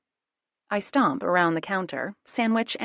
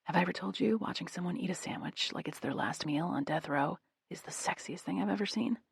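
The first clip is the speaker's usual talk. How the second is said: much softer, leaning in close; soft half-whisper; 9 dB quieter than usual. A narrator's reading. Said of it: "and whispers".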